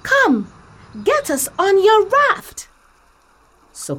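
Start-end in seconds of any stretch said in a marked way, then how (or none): 0.0-2.7 high, louder little straw voice begging for help; squeaky straw asking for rescue, urgent; Emmy the straw pleads with Kim to save her family; Emmy the straw pleading. Acted. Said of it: "Emmy explained".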